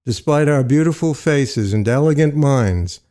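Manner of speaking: plain reading voice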